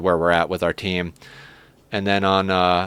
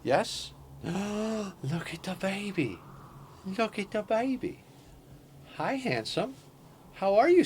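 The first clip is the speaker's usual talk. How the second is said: pet-talk voice; 8 semitones higher, 10 dB quieter than usual. Real, not acted. Streamer to her cat, high soft baby talk.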